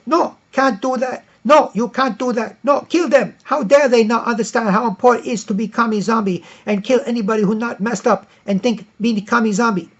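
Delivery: hammed-up zealot snapping no; mock zealot. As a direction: shout down the reasonable one. Zealot reply, acted.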